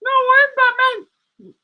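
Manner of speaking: squeaky high insistent Batman; high puppet Batman. Acted. Puppet insisting it is the real Batman, high. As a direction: counter him in the high toy voice, slowly.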